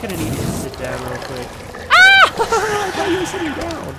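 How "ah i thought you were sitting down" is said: high startled yelp then laugh; startled shriek. Real, not acted. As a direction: jump and shriek in surprise. Startled by plant.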